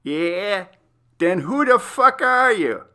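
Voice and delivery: high loud jeering shout; taunting inmate yelling down the corridor; mocking yell. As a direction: shout it back through the cell bars. Another inmate taunts him.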